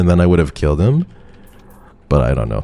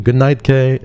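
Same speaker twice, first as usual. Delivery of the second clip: sweet goodnight; 10 semitones higher, 4 dB louder than usual. Real, not acted. Tender goodnight to chatter.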